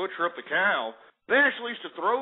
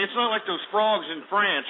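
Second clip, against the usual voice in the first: ordinary talk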